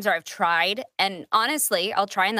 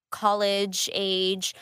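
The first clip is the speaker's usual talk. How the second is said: ordinary delivery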